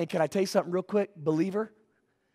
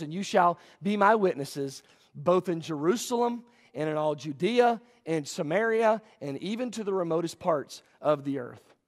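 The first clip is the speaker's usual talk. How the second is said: lower, measured Bible reading; measured scripture reading; 4 semitones lower, 3 dB quieter than usual. Real, not acted. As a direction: read the verse out evenly, lower. First reading of closing verse.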